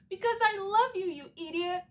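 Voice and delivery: light mock of Rory blurting it out; imitating Rory. Rory confessing her love to Dean, mock blurted confession.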